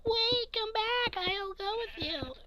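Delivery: put-on girl calling him back. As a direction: call after him like the girl from the movies. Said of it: "the girl's like".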